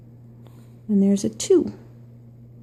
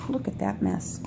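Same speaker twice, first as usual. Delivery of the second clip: usual speaking voice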